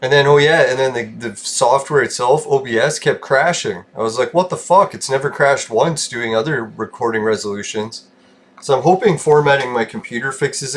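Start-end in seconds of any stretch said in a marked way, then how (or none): 4.3-4.9 retold exasperated disbelief; high-pitched incredulous quote; baffled reaction. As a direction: act out bafflement with the pitch raised. Retelling his reaction to software crashes.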